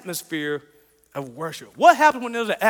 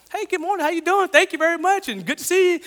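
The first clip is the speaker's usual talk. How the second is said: bright, much higher, friendly hello to the agent; cheerful greeting; 9 semitones higher than usual. Retold or imitated. Pastor greeting the airport security agent, high and cheerful. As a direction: greet her sunnily so the line brightens up. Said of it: "you're so cheerful this morning".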